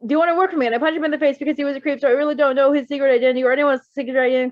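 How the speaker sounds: fast, rushed, tumbling words; nervous ramble. Acted. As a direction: blurt it all out in one breathless rush. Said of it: "he blurted".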